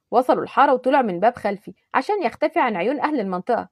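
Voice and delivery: usual speaking voice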